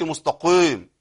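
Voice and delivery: «المستقيم» with an exaggerated, too-heavy qaf; slowly over-darken the ق in «المستقيم». Shown as a demonstration. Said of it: "أنزل بالقاف إلى أدنى مرتبة". («المستقيم» بقاف مبالغ في تفخيمها)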